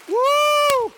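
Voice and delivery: very high, loud "woo"; jubilant whoop. Real, not acted. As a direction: let out a piercing whoop of joy. Celebrates the grandson eating.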